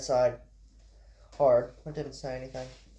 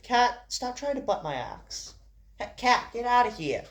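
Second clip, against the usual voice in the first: high-pitched real call at a pet; scolding the cat; 9 semitones higher than usual. Real, not acted. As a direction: shoo the cat away, pitch way up. Shooing the cat mid-recording.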